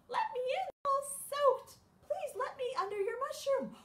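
very high, loud squeaky plea; mouse voice. Acted. Mouse begs the insects to let it in, high and squeaky. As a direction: beg like a tiny soaked mouse.

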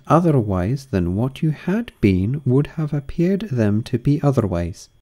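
high grand duchess voice; imperious plummy grande dame. Acted. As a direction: purr like a grand old duchess.

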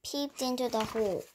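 ordinary delivery, nothing marked

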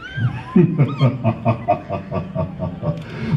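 a smug, rising villain laugh; Freezer's evil laugh. Acted. Voice actor showing Freezer's first laugh. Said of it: "Dice así".

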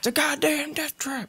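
high-pitched, hammed-up soldier; own dubbed game voice. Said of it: "I'm putting my own voices to it".

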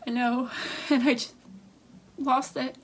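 sobbing through the words; real crying. Real, not acted. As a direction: voice cracking with real grief. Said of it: "sorry, I'm trying to get myself together".

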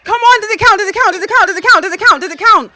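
high, loud, repeated pleading; desperate yelling at the screen; frantic shouting. Real, not acted. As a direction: beg the ref over and over. Tense wait for a goal decision.

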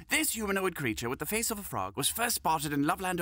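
usual speaking voice

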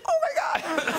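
a giddy squeal of disbelief; excited shriek. Retold or imitated. Guests at a table hearing Oprah is next, shrieking excitedly. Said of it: "And we're like".

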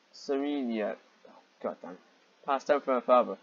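ordinary delivery

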